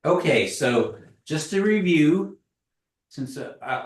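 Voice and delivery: usual speaking voice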